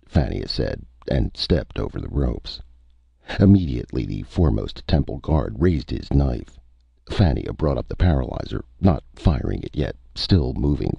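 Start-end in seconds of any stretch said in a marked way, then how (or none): none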